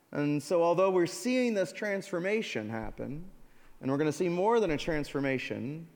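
ordinary talk, nothing marked

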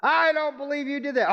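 high-pitched retold shout of disbelief; mock shouting. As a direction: imitate a loud-mouthed friend announcing it to everyone.

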